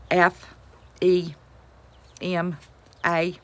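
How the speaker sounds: F, E, M, A as separate letters with a pause after each; each letter of FEMA said on its own; spelled out letter by letter. Shown as a demonstration. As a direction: spell FEMA out slowly, one letter at a time. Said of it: "only I said the word on the phone".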